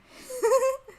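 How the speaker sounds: squeaky high-pitched giggle. Real, not acted. High laugh after outburst.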